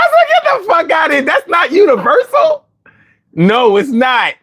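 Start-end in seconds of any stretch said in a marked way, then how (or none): 0.3-2.7 mock outraged shout. Retelling his outrage at the dog commands, shouting. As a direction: yell it in laughing disbelief.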